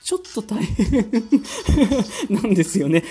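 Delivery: breaking into real laughter; laughing. Real, not acted. Laughs at own excuse.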